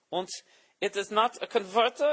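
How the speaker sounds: imitating the pedantic Helsinki guy in a mock Finnish accent; Finnish guy imitation. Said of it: "That fucking guy in Helsinki".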